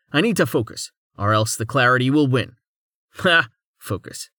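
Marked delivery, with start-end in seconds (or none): 3.8-4.3 breathy whisper of one word. A narrator's reading. Inner voice struggling.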